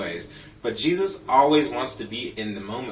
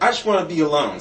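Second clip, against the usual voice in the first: retold sulky kid; 6 semitones higher, 7 dB louder, faster than usual. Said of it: "I'd stomp off, close the door".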